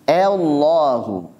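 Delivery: Allahu with the a thinned towards e, slow; overly thin reading. Shown as a demonstration. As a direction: Allahu said too thin, like elohu. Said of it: "Ini berlebihan".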